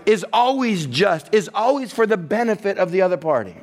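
ordinary talk, nothing marked

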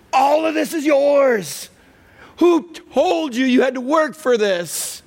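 high, slow, swelling plea; open-hearted dad calling out to his son; pleading father. Acted. Father running to his elder son, pleading high and slow.

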